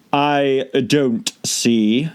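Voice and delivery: languid, very slow creature voice; slow caterpillar drawl. A narrator's reading. Caterpillar drawling at Alice, very slow. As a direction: stretch each word out lazily.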